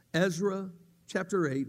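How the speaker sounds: ordinary talk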